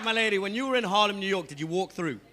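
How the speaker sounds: usual speaking voice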